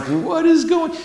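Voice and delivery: sudden high-pitched puzzled cry; bewildered surprise. Retold or imitated. Eclipse watchers bewildered as the light turns weird, high surprised cry. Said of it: "everybody's like".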